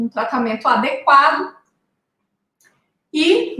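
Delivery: normal talking voice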